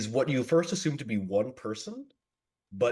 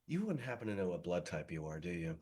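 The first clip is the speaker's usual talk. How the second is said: quieter, quick and sly; soft menacing question; 3 semitones lower, 7 dB quieter, faster than usual. Acted. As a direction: ask sweetly like a predator. Vampire asks victim's blood type.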